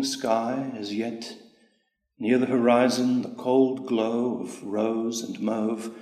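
measured, unhurried recital of a poem; poem reading. Acted. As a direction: read it like a poet savouring each line. Poet reads his own poem.

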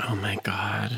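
breathless whisper of disbelief. Real, not acted. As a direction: whisper it, stunned. Reacting in disbelief.

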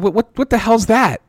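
high, loud stammering disbelief; a listener caught off guard, asking in shock; stunned disbelief. Retold or imitated. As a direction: sputter out what the hell. Reacting to strange diagnosis.

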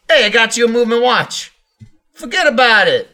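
brash East Coast gift-giver; East Coast accent. Pretend. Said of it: "Which coast are you gifting on there?".

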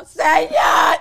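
scared shout to the police officer; frantic cry of sir. Retold or imitated. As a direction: call out to the officer in a panic.

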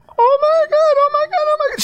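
very high excited fangirl squeal; squealing freak-out. Retold or imitated. Husband imitating his wife freaking out at the engagement text, squealing. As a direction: imitate a squealing teenage girl, extremely high-pitched. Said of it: "She's, like, squealing".

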